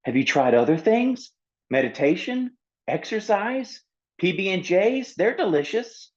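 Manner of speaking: high, bright-eyed list of ideas; eager suggestions. Acted. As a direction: sell him on alternatives. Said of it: "tried offering other solutions".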